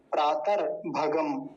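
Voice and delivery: प्रातर said slowly with a clear rolled r; slow, deliberate recitation; careful pronunciation. Shown as a demonstration. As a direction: sound out प्रातर भगम slowly, syllable by syllable. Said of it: "इसको ध्यान रखेंगे".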